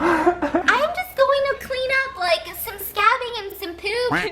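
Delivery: slow, squeaky retelling of a protest; exaggerated high, drawn-out explaining; high-pitched protest. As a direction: plead it high, on the edge of laughter. Protesting to bawling sister amid laughter.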